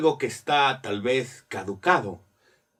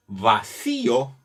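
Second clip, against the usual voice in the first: "Vacío" slowly, weight on the accented í; stress on the accent; slower than usual. Shown as a demonstration. Teacher showing where the word's weight falls, stress on the í. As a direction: lean on the í in "Vacío". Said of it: "El peso de la palabra está".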